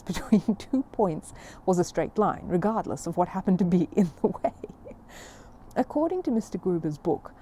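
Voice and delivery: ordinary delivery, nothing marked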